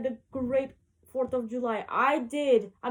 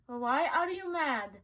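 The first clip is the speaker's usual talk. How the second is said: usual speaking voice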